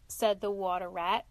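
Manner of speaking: ordinary delivery, nothing marked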